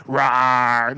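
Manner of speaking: lightly voiced low rawr, the wrong way; a tame half-hearted roar; weak roar. Shown as a demonstration. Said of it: "gotta be not like".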